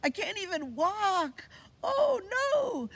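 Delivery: put-on high, loud panicky baby voice; panicked newborn. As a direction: squeal like a helpless baby in panic. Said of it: "I'm like".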